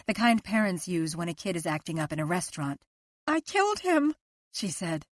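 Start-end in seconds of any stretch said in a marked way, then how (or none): 3.3-4.1 much higher, stricken; anguished confession. A narrator's reading. Liz confessing to husband, anguished and higher. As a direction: blurt it out in anguish.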